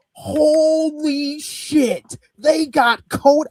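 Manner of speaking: high, slow, amazed cry of disbelief; retold shock at the surprise guest, high and drawn out; stunned excitement. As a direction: gasp it out like you can't believe who just walked in. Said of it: "I'm like".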